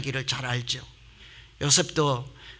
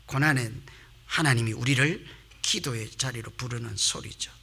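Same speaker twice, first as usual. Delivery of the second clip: ordinary talk, nothing marked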